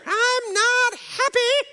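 very high, slow whiny wail; mock an adult pouting like a child; sulking churchgoer mocked as a toddler, whiny wail; grown-up sulking like a toddler. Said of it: "And you're still sitting there".

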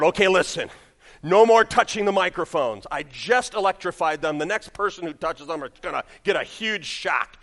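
loud, emphatic retold warning; mock stern announcement. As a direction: lay down the law, playacting.

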